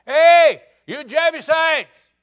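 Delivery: slow, very loud, high call; a boy shouting a challenge at the fortress; hollering up the hill. Retold or imitated. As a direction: bellow up at the wall. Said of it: "he hollered and said".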